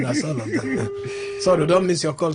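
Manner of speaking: usual speaking voice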